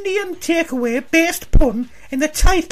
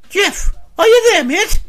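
louder call, higher pitch; 4 semitones higher, 9 dB louder than usual. Real, not acted. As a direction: raise your voice to get his attention. Host calls again, no answer.

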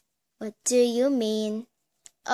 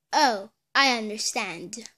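ordinary talk

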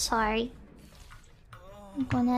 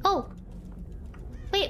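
normal talking voice

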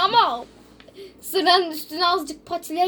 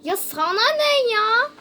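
higher-pitched annoyed comeback; indignant retort; 6 semitones higher, 4 dB louder than usual. Acted. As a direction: snap back, mock indignant. Öğretmen öğrenciye çıkışıyor. (sinirli çıkışma)

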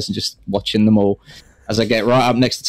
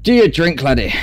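higher, lightly slurred Scottish-ish brogue; slightly slurred; 5 semitones higher than usual. Acted. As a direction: slur it a touch like a tipsy dwarf. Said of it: "kind of slightly slurred be like".

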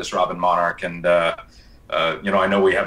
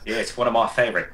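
put-on Australian accent; 4 semitones higher than usual. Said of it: "can you answer in that accent".

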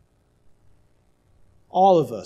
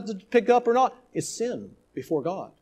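ordinary talk, nothing marked

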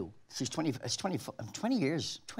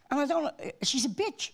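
raised fond voice; 12 semitones higher, slower than usual. Real, not acted. Describing Agnes, pitch up.

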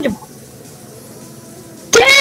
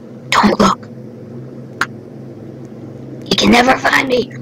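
hushed unvoiced whisper, lower; 6 semitones lower, 6 dB quieter than usual. Real, not acted. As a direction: breathe it as a whisper. Whispering to self in horror game.

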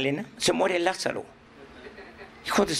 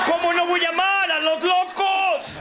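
exuberant shout; 11 semitones higher, 4 dB louder than usual. Real, not acted. Speaker to the laughing group, exuberant shout. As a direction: proclaim it to the whole room.

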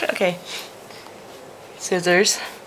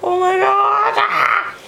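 high, loud joyful yell; delighted shout; 13 semitones higher, 3 dB louder, slower than usual. Real, not acted. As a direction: shout it out with joy.

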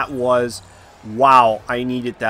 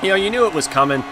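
ordinary talk, nothing marked

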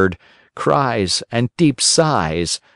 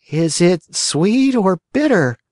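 put-on high, slow childish whine; whining; 10 semitones higher, slower than usual. A narrator's reading. Sick Pinocchio asks the fairy about the medicine, whining. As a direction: whine like a spoilt sick boy. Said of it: "asked in a whining voice".

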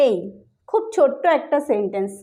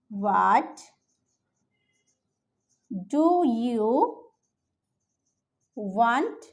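word by word dictation; 3 semitones lower, 5 dB quieter, much slower than usual. Shown as a demonstration. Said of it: "এভাবে জিজ্ঞেস করতে পারি".

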